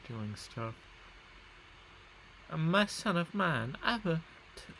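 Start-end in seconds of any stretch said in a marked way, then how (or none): 2.5-4.7 attempted Italian accent. Gamer playing a ruler addressing his son, attempted Italian accent. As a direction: have a go at an Italian accent and miss. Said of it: "that is not an Italian accent at all".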